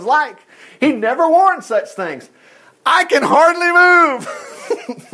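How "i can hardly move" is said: high, slow, laughing struggle; a boy straining under armor, laughing; David in armor. Acted. David stuck in heavy armor.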